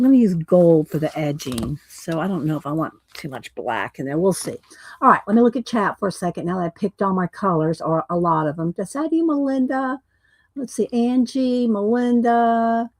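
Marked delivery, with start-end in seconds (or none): none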